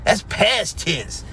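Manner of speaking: lightly voiced smug critic, drawn out; mocking a critic. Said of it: "I saw an asshole the other day said".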